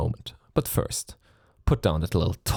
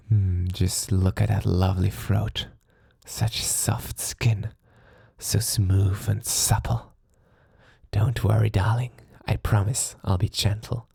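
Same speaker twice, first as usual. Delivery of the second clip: seductive whisper; 2 semitones higher than usual. Acted. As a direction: breathe the words onto her neck as he savours her. Vampire leaning to captive's neck.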